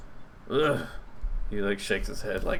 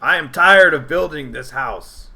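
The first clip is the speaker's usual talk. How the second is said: louder goblin, fed up; a goblin sick of rebuilding; weary goblin; 4 semitones higher, 7 dB louder than usual. Acted. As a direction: goblin grumbling about his rebuilt house. Goblin NPC complaint.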